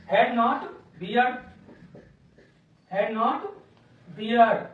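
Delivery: very slow, word by word for the board; slow dictation. Shown as a demonstration. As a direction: spell it out as you write. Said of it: "teacher dictates while writing". (धीरे-धीरे लिखवाते हुए)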